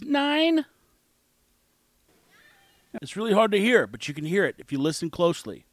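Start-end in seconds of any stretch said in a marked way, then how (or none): 0.0-0.6 put-on much higher child voice; mimicked child's high-pitched reply; imitated little girl. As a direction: answer high like a small girl. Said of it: "where she says".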